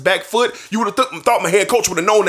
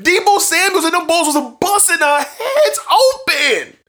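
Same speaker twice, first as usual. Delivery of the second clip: impassioned rant; 12 semitones higher, slower than usual. Real, not acted. Fan ranting to his podcast audience about the playoff loss, high pained wailing. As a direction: wail it out like a heartbroken fan. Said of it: "stop my crying, my belly aching, and my moaning".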